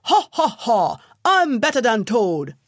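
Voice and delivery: loud high braying boast; donkey bawling at the milepost; bawled boast. A narrator's reading. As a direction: crow it out in triumph. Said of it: "he bawled".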